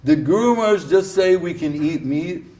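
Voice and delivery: alarmed question. Retold or imitated. Devotee at the door asking in alarm. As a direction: ask it aghast. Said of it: "He goes".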